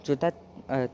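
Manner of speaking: usual speaking voice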